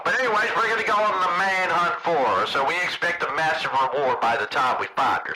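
ordinary talk

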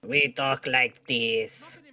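drawn-out mock-French robot voice; slow, plodding French-accented Cyberman. Mocking the on-screen Cyberman, French robot voice. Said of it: "He sounds like a French dude".

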